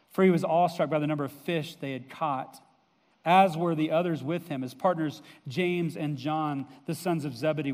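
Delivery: ordinary talk, nothing marked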